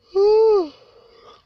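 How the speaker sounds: a big, high, loud exhale of tiredness; loud sigh. Real, not acted. Tired pregnant vlogger sighs loudly at the camera. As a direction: let out a heavy sigh.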